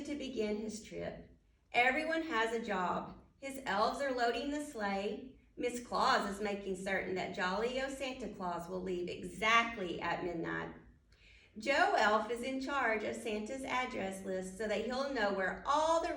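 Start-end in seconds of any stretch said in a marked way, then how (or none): none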